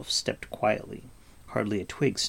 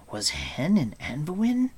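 put-on higher, questioning young hero's voice; surprised; 6 semitones higher than usual. A narrator's reading. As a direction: ask it in startled disbelief. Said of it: "Taran asked with surprise".

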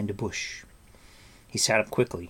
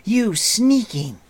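high, loud, drawn-out accusation; furious cry; 12 semitones higher, 10 dB louder, slower than usual. A narrator's reading. Taran shaking the creature, furious. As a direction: hurl the insult furiously. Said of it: "Taran cried furiously".

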